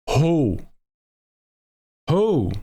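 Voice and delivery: high owl hoot, dropping at the end; East Coast owl. Retold or imitated. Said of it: "my impression of an owl".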